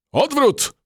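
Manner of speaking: shouted order. A narrator's reading. Captain shouts retreat to his cockroach company. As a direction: yell retreat to the troops. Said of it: "krzyknął kapitan".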